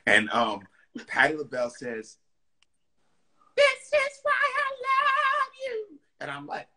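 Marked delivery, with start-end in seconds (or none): 3.6-6.0 high falsetto impression of a diva; sing-song high diva voice, very high; high, airy diva impersonation. [name] voiced in story.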